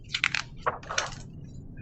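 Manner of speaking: sharp breathy gasp of realisation; excited gasp. Acted. Librarian realising the animals team up, gasp. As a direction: gasp in delight.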